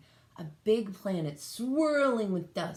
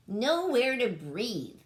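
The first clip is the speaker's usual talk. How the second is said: gasped; 6 semitones higher, 4 dB louder, slower than usual. Acted. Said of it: "he gasped".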